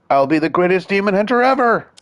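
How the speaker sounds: high loud boast; boastful shout. Acted. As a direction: brag at full volume. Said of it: "Despite his boasting".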